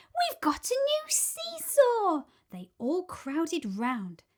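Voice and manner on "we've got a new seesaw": put-on high, slow child voice, thrilled; excited child voice. A narrator's reading. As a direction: sound like a delighted kid. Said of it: "they cried".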